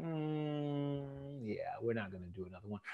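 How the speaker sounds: a soft, offhand brush-off with a slight hum; dismissive UFC voice. Retold or imitated. Fighter imitating the UFC brushing off a rematch, dismissive. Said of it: "the U F C were kinda like".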